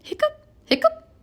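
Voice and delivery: high squeaky hiccups; comic high hiccups; hiccup sounds. A narrator's reading. Pete's hiccups voiced in story.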